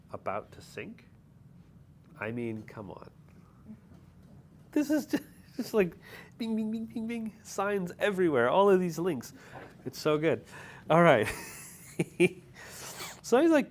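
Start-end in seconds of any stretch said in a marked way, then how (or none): 4.7-9.3 high excited rush; bubbling over with excitement. Real, not acted. Excited about links.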